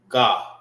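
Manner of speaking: "God" said firmly as one clear syllable with the D landed at the end; Hebrew pronunciation. Shown as a demonstration. Teacher showing the class how "God" is said in Hebrew. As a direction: land "God" firmly and finish on the D. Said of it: "how you say Gad in the Hebrew".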